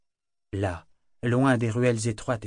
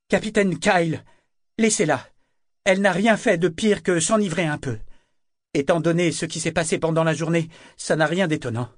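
raised, higher-pitched appeal; urgent intervention; 6 semitones higher, 5 dB louder than usual. A narrator's reading. Sailor asks Kyle to release Althea, urgently. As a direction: step in firmly on her behalf.

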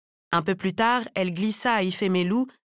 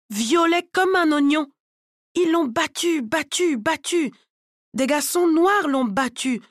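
higher-pitched mother, emphatic and upset; aggrieved Halima; 5 semitones higher than usual. Acted. Halima telling a client of her son's beating, aggrieved. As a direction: drive home each painful detail, pitched high.

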